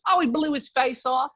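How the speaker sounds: high-pitched mock Georgian accent. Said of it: "like, Georgian accent".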